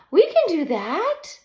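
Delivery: high, loud eager chorus; high loud children's chorus; kids shouting. A narrator's reading. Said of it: "shout in unison".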